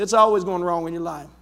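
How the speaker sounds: low, fast, unimpressed voice of God; tossed off low and quick, unimpressed; God's weary reply. Retold or imitated. God answering the complainer, low, fast and unimpressed. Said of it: "he's looking out of heaven and said".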